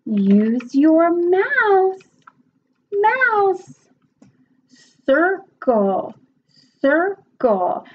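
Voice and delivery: slow, high, exaggerated teacher voice for a child; teacher demo voice. Shown as a demonstration. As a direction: drag out each word for a young learner. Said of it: "You can say".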